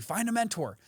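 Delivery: lightly mocking the advice everyone gives. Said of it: "Everyone tells you".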